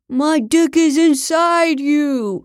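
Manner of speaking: high, slow, mock-ecstatic cry; faked passion. A narrator's reading. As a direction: call it out in hammed-up pleasure. Faking loud sex for cameras.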